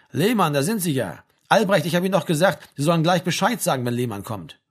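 a touch of barracks authority; Spieß. Acted. Narrator voices the sergeant. (leicht angedeuteter Spieß, lauter im Kommandoton)

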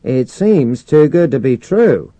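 sarcastic radio reply, stretched out; echoed sarcasm. A narrator's reading. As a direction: draw it out with pointed irony. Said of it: "echoing the sarcasm in Mercer's voice".

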